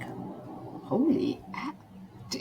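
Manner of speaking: slow, breathless whispered gasp of shock; stunned whisper. Retold or imitated. Hearing the couple married with kids, stunned whisper. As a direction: let it die in disbelief.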